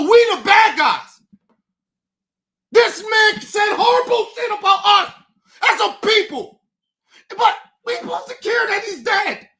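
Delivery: yelling it out in real outrage; real fury, voice cracking once; furious shouting. Real, not acted. Furious rant about Charlie Kirk.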